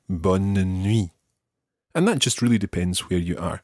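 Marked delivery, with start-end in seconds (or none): 0.1-1.1 BONNE NUIT with a short "uh" after BONNE; mini middle syllable. Shown as a demonstration. Teacher repeating the variant, mini middle syllable. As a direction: give BONNE NUIT three syllables, bon-nuh-nwee. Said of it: "with almost a mini syllable in the middle there".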